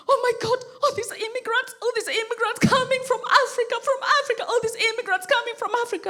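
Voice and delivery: hammed-up alarmed Brit; panicked tabloid reader, played up high; mock panic. Panicking Brits voiced.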